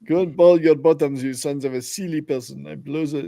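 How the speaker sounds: mock French taunting accent. Friend quoting the French guard's insults, mock French accent. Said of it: "you gotta do the French accent".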